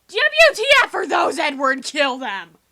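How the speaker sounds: high, loud panicked scream; panicked shriek. Retold or imitated. Bella yelling at Edward about the giant birds, high and loud panic. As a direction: yell at him to kill them. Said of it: "Bella's like".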